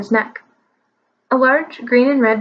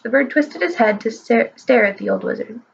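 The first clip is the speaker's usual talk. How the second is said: ordinary delivery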